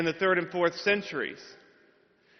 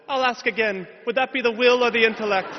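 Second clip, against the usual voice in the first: Irish professor; 6 semitones higher, 5 dB louder than usual. Retold or imitated. Professor repeats question pointedly.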